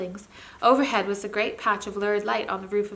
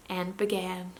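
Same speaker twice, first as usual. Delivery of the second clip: usual speaking voice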